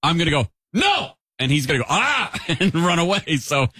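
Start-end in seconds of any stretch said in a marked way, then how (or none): 0.7-1.1 sudden high-pitched "No!"; mock yell. Owner catching the cat on the counter, mock yell. 1.9-2.3 high startled "Ah!"; startled yelp. Acted. Cat running away from owner, mock startled yelp.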